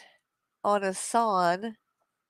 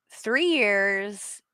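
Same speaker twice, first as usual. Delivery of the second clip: usual speaking voice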